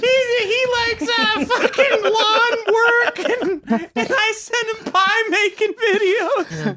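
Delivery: high, slow, breathless laughter; real fit of giggles mid-sentence; laughing through words. Real, not acted. Host describing his own couple's videos, laughing.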